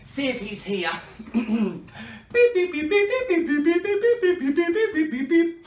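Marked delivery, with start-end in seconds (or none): 1.3-5.7 high, rapid imitation phone beeps; dialling beeps. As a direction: beep like a phone being dialled.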